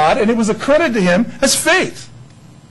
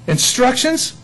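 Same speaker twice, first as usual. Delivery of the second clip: ordinary talk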